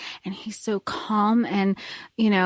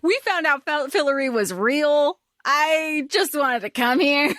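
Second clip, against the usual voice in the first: pitched-up, laughing plea; high whiny protest; 6 semitones higher than usual. Retold or imitated. Host voicing the kids pleading with the Beast, high and laughing. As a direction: whine it out, half laughing.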